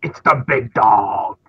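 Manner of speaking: slow, drawn-out commentator impression; Michael Cole voice. Co-host doing the commentator impression on request, Michael Cole voice. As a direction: call it like a wrestling announcer. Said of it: "Do it in your Michael Cole voice".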